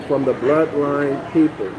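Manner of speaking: ordinary talk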